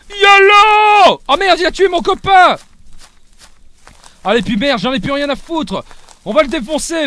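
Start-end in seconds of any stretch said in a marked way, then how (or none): 0.1-1.2 loud, high, drawn-out battle yell; charging yell. Real, not acted. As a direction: throw yourself in screaming. Charging into the fight.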